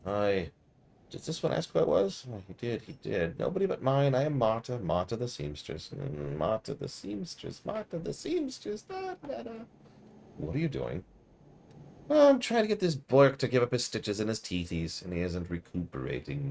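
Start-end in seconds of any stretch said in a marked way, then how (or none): 1.1-9.5 put-on high, muttering old seamstress, sing-song; croaky old seamstress. Player asks who she is, old seamstress answers in high mutter. 12.1-16.5 put-on high, muttering old seamstress, sing-song; croaky old seamstress. Player asks who she is, old seamstress answers in high mutter. Voices Marta.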